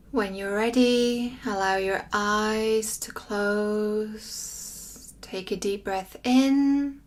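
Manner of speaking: slow, drawn-out meditation voice with long pauses; guided meditation. Acted. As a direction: guide listeners gently through breath and body, lingering on words.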